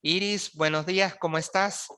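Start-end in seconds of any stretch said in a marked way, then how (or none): none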